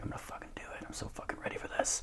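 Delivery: whispered self pep talk; intense whisper. Retold or imitated. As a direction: psych yourself up in a hushed, quick whisper. Retold psyching himself up for final.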